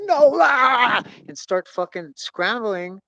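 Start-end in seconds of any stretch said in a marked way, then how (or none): 0.1-1.0 panicked cry. Retold or imitated. Panic scrambling in surf.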